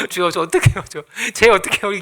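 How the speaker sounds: lightly hammed-up helpless plea to God; playful retold cry for help; mock pleading prayer. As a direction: plead half-laughing, as if at a loss. Acts out his own helpless prayer.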